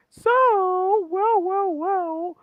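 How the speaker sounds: high, drawn-out sing-out impression; Grace Randolph. Host mimicking a movie-talk personality for friends, high drawn-out impression. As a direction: mimic her with long, high-pitched words. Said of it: "you have Grace Randolph going".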